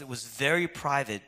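normal talking voice

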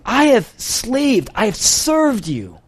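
high, slow, bitter grievance; elder son griping to his father, slow and aggrieved; resentful complaint. Retold or imitated. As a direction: drag out each word like a wronged son. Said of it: "He says".

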